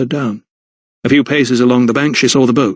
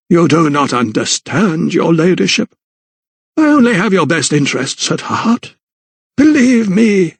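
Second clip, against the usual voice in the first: menacing vicar; 6 semitones higher than usual. A narrator's reading. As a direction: press your case like an unhinged clergyman. Menacing vicar pleads with Lydia.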